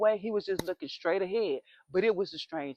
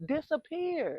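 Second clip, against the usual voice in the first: mock spooky neighbor; 6 semitones higher, slower than usual. Neighbor voiced dramatically.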